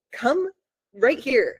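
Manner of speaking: high, insistent call-over; impatient beckoning. Retold or imitated. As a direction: act out summoning someone into the shot. Retelling beckoning Alex into footage.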